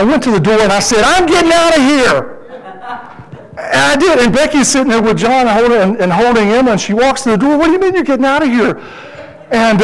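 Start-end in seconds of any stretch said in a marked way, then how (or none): 1.0-2.3 higher-pitched retold outburst of frustration; exasperated outburst. Fed-up father to wife at the door, exasperated. 7.6-8.8 indignant challenge. Retold or imitated. Wife challenges him at the door.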